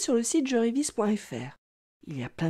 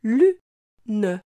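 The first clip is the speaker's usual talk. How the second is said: "Lune" as lu... ne, pause between; split into syllables; 4 dB louder than usual. Shown as a demonstration. Teacher helping the child hear lu, syllable by syllable. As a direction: break Lune in two, voicing the final e. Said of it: "Tu entends bien la syllabe lu".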